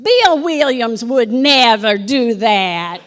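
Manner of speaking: loud, slow laughing call from the hallway; Nanny calling out. Retold or imitated. Nanny defends Bill from the hallway, loudly, laughing. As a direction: call it in indignant and amused. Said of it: "I hear Nanny in the hallway".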